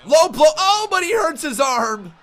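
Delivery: loud, high, drawn-out ringside call; wrestling commentator. Real, not acted. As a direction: shout the finish like a commentator. Live call of the finish.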